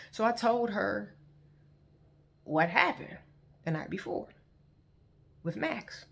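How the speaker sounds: ordinary delivery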